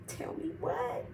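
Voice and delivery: shocked, barely getting the words out; shocked high whisper. Retold or imitated. Girlfriend asks boyfriend what he hides, shocked whisper.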